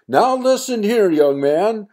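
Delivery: high, loud scolding mother; stern mother. Acted. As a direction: tell him off like an angry mom. Mother scolding her son.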